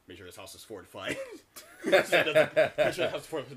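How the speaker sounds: giggling, loud, mocking the line; laughing through a quote. Mimicking character, laughing.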